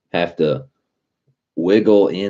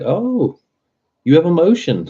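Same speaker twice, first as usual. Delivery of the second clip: much higher, fake-amazed voice; mock surprise; 13 semitones higher, 4 dB louder than usual. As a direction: gush in exaggerated mock wonder. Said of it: "And I was like".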